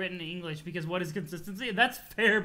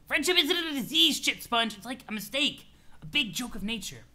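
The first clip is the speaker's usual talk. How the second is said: irritable troll, scolding; 4 semitones higher than usual. Acted. Troll corrects his friend about friendship, irritable scolding. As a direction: snap back in acted exasperation.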